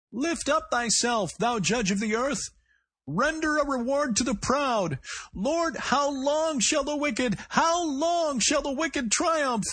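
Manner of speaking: shrill, high, slow crazed preacher's cry; high strained cry. A narrator's reading. Gunman crying scripture from inside the store, high and strained. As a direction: wail the psalm like a man at breaking point.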